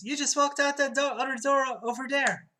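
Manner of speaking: high, loud acted insistence; flustered protest. Clerk to man, insisting he just left, flustered =.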